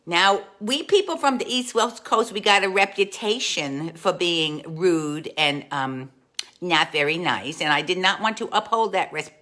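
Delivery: ordinary talk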